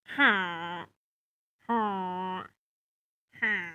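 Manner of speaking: low, drawn-out villager "huh"; low nasal-free villager grunts, spaced apart; Minecraft villager noise. Shown as a demonstration. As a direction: dumber Squidward grunt with the tongue out a little. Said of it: "I make a sick-ass Minecraft villager noise".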